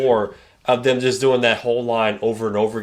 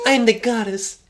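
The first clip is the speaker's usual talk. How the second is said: high silly diva voice; mock diva voice; 11 semitones higher than usual. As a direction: declare it like a hammy diva. Mock goddess line.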